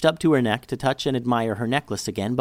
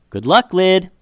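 louder, higher call across the yard; hollered; 7 semitones higher, 8 dB louder than usual. Acted. Jessica hollering goodbye to Liddy across the yard. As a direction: holler it after her as she leaves. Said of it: "Jessica hollered out to Liddy".

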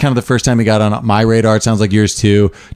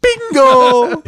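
high, ringing exclamation of delight; high, drawn-out movie quote; Christoph Waltz; 13 semitones higher than usual. Acted. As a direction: sing it out high with hammed-up glee. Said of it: "This is Christoph Waltz".

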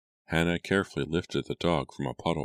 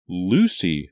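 louder, emphatic naming of the culprit; insistent repetition; 3 semitones higher, 8 dB louder than usual. A narrator's reading. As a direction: say the name as a loud accusation. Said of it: "her sister repeated".

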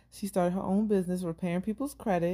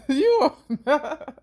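laughing; 8 semitones higher, 8 dB louder, slower than usual. Real, not acted. Teasing protest.

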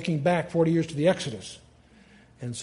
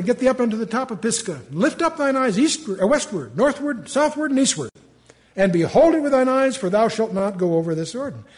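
much higher, louder verse reading; scripture reading; 7 semitones higher, 6 dB louder than usual. Acted. Teacher reads God's command to Moses. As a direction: proclaim the verse grandly.

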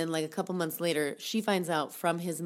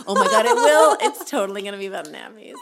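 loud, high laughing outburst; real laughter, much higher and louder; 4 semitones higher, 14 dB louder than usual. Real, not acted. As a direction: crack up mid-sentence. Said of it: "laughing at the nappies joke".

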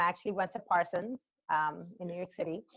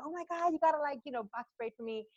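high-pitched retold pleading; supplication rejouée; 8 semitones higher, 5 dB quieter, faster than usual. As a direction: mildly mimic begging a sister for a favor. Voicing younger self begging sister.

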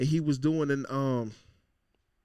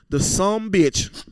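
slow, louder drawn-out Southern accent; 3 semitones higher, 7 dB louder, slower than usual. Shown as a demonstration. Host mocking O J on the podcast, Southern accent. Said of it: "I'm gonna say it like I'm Southern".

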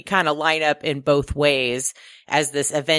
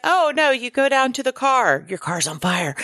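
higher, sing-song helpful stranger voice; overly polite local; 9 semitones higher than usual. Retold or imitated. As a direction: play a lightly voiced guy who's too polite to mention the fire. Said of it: "because he's polite, he's like".

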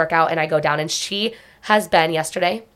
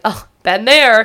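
slow, higher, louder kindly sigh of understanding; sympathetic mom; 4 semitones higher, 6 dB louder, slower than usual. Retold or imitated. A kind mom consoling another, slow sympathetic voice. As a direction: reassure a fellow mom, slowly. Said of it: "every mom would ... be like".

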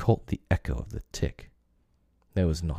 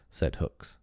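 usual speaking voice

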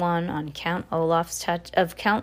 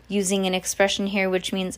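usual speaking voice

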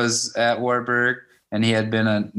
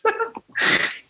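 bursts into high laughter; short high-pitched laugh. Real, not acted. Laughing at own story.

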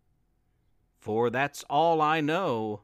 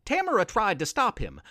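usual speaking voice

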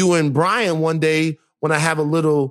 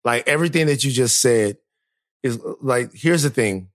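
reassuring; 6 semitones lower than usual. Real, not acted. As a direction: soothe her gently. Cohost comforting upset host.

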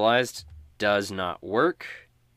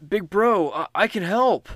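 much higher, louder little-brother voice; eager kid brother; 12 semitones higher, 9 dB louder than usual. Acted. Sly offers to help his big brother, high and eager. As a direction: plead to help like an eager youngster. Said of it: "he looks at you and he says".

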